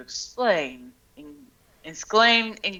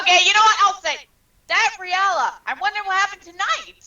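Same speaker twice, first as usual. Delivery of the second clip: Sonic Adventure voice; 12 semitones higher, 5 dB louder, faster than usual. Pretend. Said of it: "Sonic Adventure reference".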